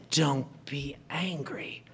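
slow, hushed whisper; soft whispered "don't be angry", slow; whispered counsel. Acted. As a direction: breathe it gently as advice. Jesus gently counsels in quote.

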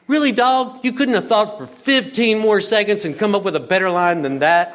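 loud, high sarcastic retort; mother scoffing at the dorm dad's awkward greeting, loud and incredulous; mock incredulous. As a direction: roll your eyes and scoff at the line. Mocking retort to dorm dad.

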